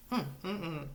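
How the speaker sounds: usual speaking voice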